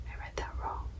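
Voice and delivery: soft, voiceless whisper; whispered aside. Real, not acted. Poet admitting a slip after the poem, whispered aside. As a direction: whisper it under your breath.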